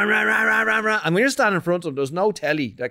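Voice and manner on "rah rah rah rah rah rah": higher mock race-car roar "rah"; retelling the Grand Prix cars roaring past, imitated engine noise; engine roar imitation. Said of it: "they had that rah".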